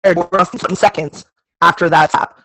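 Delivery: glitchy robotic audio; breaking up like a cyborg; half robot voice. Real, not acted. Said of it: "You're sounding like a cyborg right now".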